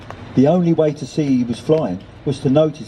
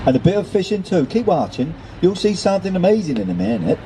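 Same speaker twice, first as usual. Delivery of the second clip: put-on higher-pitched rural accent, Kentish slipping into Fens; Fens-like country accent; 6 semitones higher than usual. A narrator's reading. Gull promises Nelson a show, in a Fens-like Kentish accent. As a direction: attempt coastal Kentish, landing nearer the Fens. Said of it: "that sounds more like the Fens".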